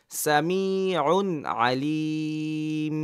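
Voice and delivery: Samiun Alim read very slowly; slow drill. Shown as a demonstration. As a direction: draw the phrase out syllable by syllable. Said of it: "কোনো সময় দেওয়া যাবে না".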